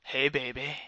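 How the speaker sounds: low, quiet imitation of a creep; mock sleazy murmur; creepy come-on. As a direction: creep out a soft, low greeting. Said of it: "like, all creepy".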